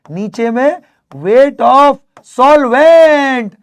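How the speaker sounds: solvent shouted high and loud; loud emphasis. Shown as a demonstration. Teacher shouting the denominator at students. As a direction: punch solvent, the key word. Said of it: "teacher stressing denominator".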